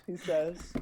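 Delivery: usual speaking voice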